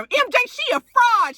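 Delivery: high, heated mock tattling; put-on indignant high voice; mimicked accusation. Mimics Prima Donna telling on her.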